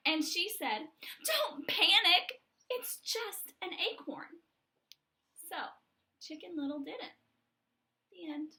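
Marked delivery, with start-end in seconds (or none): 1.2-4.4 high and loud, slowed; loud reassurance. Acted. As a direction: burst in calling out calmly. Chicken bursts in to reassure.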